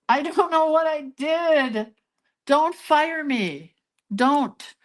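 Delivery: high, slow begging voice; playful high plea; mock pleading. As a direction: beg them not to fire you, hammed up.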